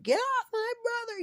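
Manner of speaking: high mock scream of a frantic sister. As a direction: shriek it like a furious little sister. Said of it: "She's fucking screaming".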